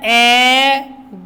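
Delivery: एग drawn out, final ग apart; sounded out. Shown as a demonstration. Teacher asking what double g makes, एग slowly segmented. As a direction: stretch the vowel of एग, pause, say "guh". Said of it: "E double जी क्या हो गया?".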